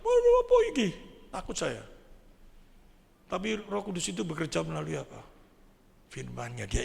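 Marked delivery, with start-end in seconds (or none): 0.0-0.9 very high panicked squeal; mock frightened scream. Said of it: "saya bisa teriak sama taci saya". (jeritan takut dibuat-buat, melengking)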